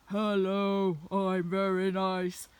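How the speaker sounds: low, slow, loud pretend dog voice; dogs' imagined tone. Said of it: "that's kind of more their tone".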